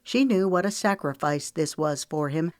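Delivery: plain reading voice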